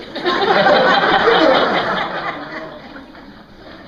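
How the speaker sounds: a loud high burst of real laughter; hearty laugh. Real, not acted. A preacher cracking up at his own childhood story, loud laughter.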